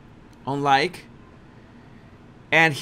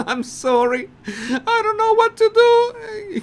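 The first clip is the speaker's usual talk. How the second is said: much higher, laughing plea; imitating an embarrassed guest giggling; hammed-up helpless apology; mock shame. Voicing ashamed laughing guest.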